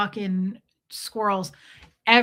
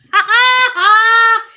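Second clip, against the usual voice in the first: very high, loud burst of laughter; loud squealing laugh; 15 dB louder than usual. Real, not acted. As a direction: shriek with delighted laughter. Delighted by her partner's words.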